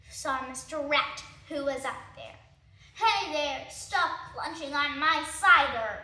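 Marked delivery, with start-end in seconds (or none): 3.0-5.9 loud, squeaky rat scolding; angry rat. Acted. Rat catching thieves drinking his cider, loud scolding.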